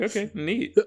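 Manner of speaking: put-on high, flat kids' "okay, neat"; high chirpy fake enthusiasm; mock kid reply. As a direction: play a dutiful child unimpressed. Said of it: "And we're like".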